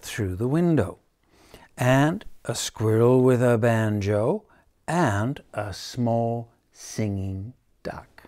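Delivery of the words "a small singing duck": slow hushed whisper. A narrator's reading. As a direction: hush it for the little duck. Storybook detail hushed.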